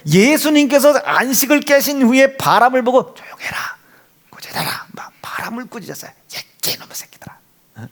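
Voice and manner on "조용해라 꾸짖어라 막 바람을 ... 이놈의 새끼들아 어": hushed whispered rebuke; whispering the scolding as if not to wake anyone. Acted. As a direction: drop to a breathy whisper, barely voiced. Dramatized rebuke of the wind.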